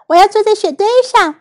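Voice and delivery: high, quick storybook exclamation; excited calling out. A narrator's reading. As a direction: call it out like an eager child. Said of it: "奥利弗大喊道".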